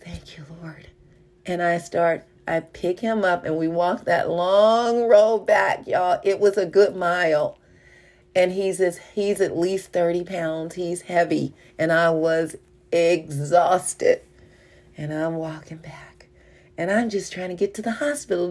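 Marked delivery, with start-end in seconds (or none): 0.0-0.9 breathless whisper of relief; barely voiced thanks, softer. Thanking the Lord after grabbing the dog, whispered. 15.0-18.5 breathless, unvoiced telling; worn out, words whispered; exhausted whisper. Real, not acted. Carrying the heavy dog a mile back, whispering.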